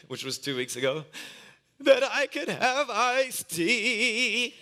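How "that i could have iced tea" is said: put-on crying little boy. Preacher voices crying son.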